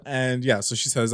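usual speaking voice

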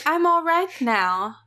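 hammed-up fake-innocent plea, stretched out; mimicking possessed Cheryl. Demon Cheryl coaxing to be let out, in a hammed-up plea. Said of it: "She's like".

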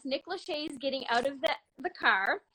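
normal talking voice

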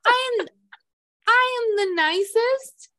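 high, put-on princess sweetness.

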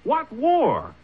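high, sharp echo of the word war; startled, throwing the word back; alarmed disbelief. Acted. Jefferson hears of war from the sheriff, alarmed disbelief.

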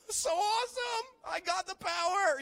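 very high, loud, hammed-up squeal of delight; giddy amazement. Teen boasting to himself after mom's healing, squealing excitedly. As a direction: gush like a kid who just found superpowers. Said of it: "I was like".

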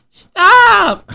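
put-on high cooing baby voice. Shown as a demonstration. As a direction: pout it out like a toddler. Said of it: "no, like, baby voice".

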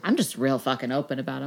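ordinary talk

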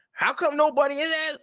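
very high, shouted put-on voice; mock outrage. Parodying the rival's rant in a high mock shout. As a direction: shriek it like a hammed-up rant.

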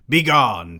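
loud, ringing "Begone"; a hammed-up loud dismissal; cried out. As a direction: banish her with a loud cry. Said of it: "Perry cried".

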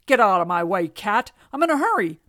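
loud, impatient donkey driver; hurried shout. A narrator's reading. Man with donkey orders cat aside, shouting impatiently. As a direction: call out sharply to clear the road.

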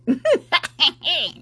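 a burst of laughter at her own dig; short real laugh. Real, not acted. Host laughing at her own jab, a quick real laugh. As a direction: laugh out loud at the insult.

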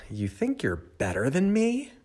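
high-pitched mock challenge; indignant cartoon question, rising high; challenging. Voices the challenging wine glass doodle.

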